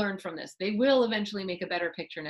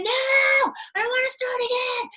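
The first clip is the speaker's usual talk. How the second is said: high, loud protest; child pushing back at the parent, high and loud; whiny child refusal; 7 dB louder than usual. Acted. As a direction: refuse loudly like a frustrated kid.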